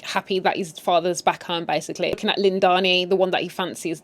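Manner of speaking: normal talking voice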